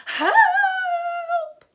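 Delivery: very high, very loud scream for help; boy screaming as he flees; shout. A narrator's reading. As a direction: scream it in panic. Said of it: "But Tom shouted".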